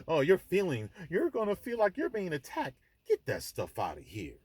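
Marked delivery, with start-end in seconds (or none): 0.1-2.8 high, mocking placating voice; mock the oversensitive, pitched up high; mock hurt feelings. Man mocking worries about feelings, mock hurt. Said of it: "I can't speak like this because".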